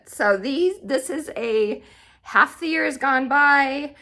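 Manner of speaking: normal talking voice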